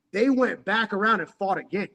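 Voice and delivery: normal talking voice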